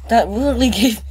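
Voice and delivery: high, shaken and loud; words tumbling out after a fright; breathless high-pitched shock; still scared. Real, not acted. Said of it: "never been so scared in my life".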